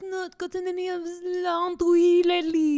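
high, loud mock-wife voice; put-on wife. One of the wives on the brothel stage, high mock complaint.

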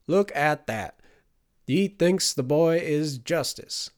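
high, loud mocking old man; gleeful old man. A narrator's reading. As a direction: jeer like a laughing old man.

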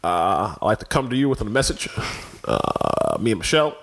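lightly voiced Obama, low growl on a few words; Obama impression. Friends ask him to do Obama, so he speaks gravely. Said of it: "Do Obama now".

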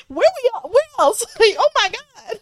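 very high, squeaky disbelief; hammed-up high-pitched horror; mock shock. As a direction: squeal it as if scandalized. Imagined shocked questioning like Oscar.

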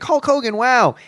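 high, awed gush at meeting a hero; mock-awestruck wrestling fan; starstruck fanboy. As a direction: gasp it like a kid seeing his idol. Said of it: "a childhood affection almost of Hulk Hogan".

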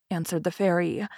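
plain reading voice

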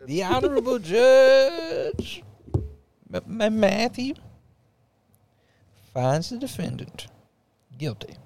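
put-on Southern judge accent. Judge pronouncing the defendant guilty, solemn Southern accent. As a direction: deliver the sentence like a Southern judge. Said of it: "I couldn't talk in that accent".

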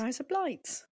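put-on Australian vowels; Australian accent. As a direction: echo a mock Australian accent. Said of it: "Australian accent".

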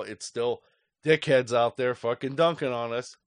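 normal talking voice

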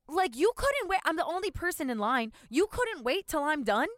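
retold outraged customer rant; mock indignant rant. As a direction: berate the clerk in disbelief. Retold rant at clerk, loud high.

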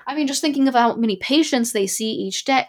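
usual speaking voice

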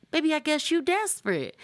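high sassy mock voice; sassy comeback. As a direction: deliver the burn like a diva. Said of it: "And then when she was like".

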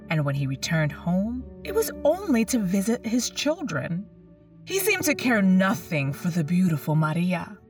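usual speaking voice

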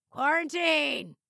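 loud, drawn-out shout from the background; deep rattling voice. A narrator's reading. Father prompting mother from the background, loud rattling call. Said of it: "said the deep, rattling voice in the background".

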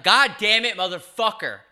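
loud, high burst of cursing; exasperated outburst. Real, not acted. Host cursing himself after botching the ad, exasperated shout. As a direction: explode in frustration at flubbing the line. Fumbles the ad read, curses.

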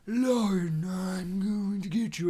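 slow, eerie villain voice; Voldemort. Acted. Villain meets Lauren in the street, Voldemort impression. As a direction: menace her in a put-on wizard voice. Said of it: "if you met Voldemort in the street and he was just like".

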